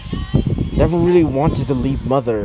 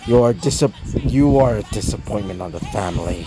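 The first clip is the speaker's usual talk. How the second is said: stern grandfather voice; 5 semitones lower, 4 dB quieter than usual. Acted. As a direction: drag each word out low like a grudging elder. Grandfather disowns, voiced in story.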